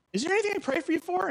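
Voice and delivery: high, rushed, timid question; nervous offer. Retold or imitated. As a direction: squeak out the offer quickly. Nervously offering prayer to stranger.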